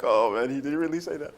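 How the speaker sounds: a hint of a shocked producer, laughing; mock the producer's disbelief; amused control room guy. Host imitating his control room guy's shock, laughing. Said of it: "he's like".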